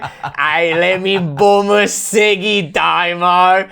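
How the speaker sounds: slow, drawn-out Aussie bogan; Australian bogan parody. Retold or imitated. Cartoon bogan asking a mate for a smoke, broad Australian accent. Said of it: "a parody of these bogans".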